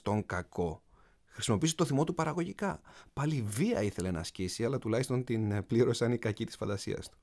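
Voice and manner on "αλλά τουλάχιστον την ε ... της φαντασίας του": ordinary delivery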